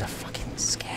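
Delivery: scared whisper. Real, not acted. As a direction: hush it like they could hear. Whisper heard, unvoiced.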